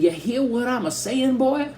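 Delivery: very high mock Southern hillbilly voice; copying the hillbilly accent. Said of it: "I said".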